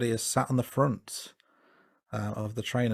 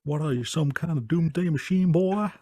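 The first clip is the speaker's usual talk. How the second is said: hammed-up sheriff accent, higher and louder; J W Pepper accent; 7 semitones higher, 5 dB louder than usual. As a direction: attempt a terrible full-on movie sheriff accent. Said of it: "full-on J W accent".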